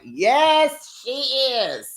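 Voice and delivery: high, gleeful exclamation; hammed-up emphatic yes; mock triumphant agreement. Mock jubilant answer to his question.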